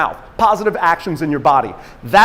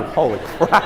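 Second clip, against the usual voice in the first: ordinary talk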